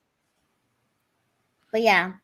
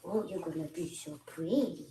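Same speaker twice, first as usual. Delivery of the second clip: put-on soft baby talk to a pet, much softer; talking to the cat; 5 semitones lower, 14 dB quieter than usual. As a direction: coo gently at the cat being bathed. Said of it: "I talked to her".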